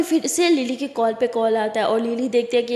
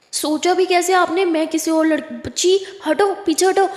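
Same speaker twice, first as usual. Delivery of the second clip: angry push-off; 5 semitones higher, 4 dB louder than usual. A narrator's reading. Lakshmi angrily pushing Lily back.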